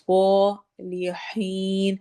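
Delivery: صالحین drawn out very slowly, heavy صاد, long یا; slow Quranic recitation of صالحین; tajweed recitation. Shown as a demonstration. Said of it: "means نہیں بولنا".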